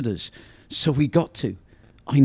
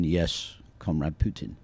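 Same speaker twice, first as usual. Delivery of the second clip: lower, softer obedient reply; quiet tones; 6 semitones lower, 7 dB quieter, slower than usual. Retold or imitated. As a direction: say it softly, like a subordinate on the phone. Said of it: "in quiet tones".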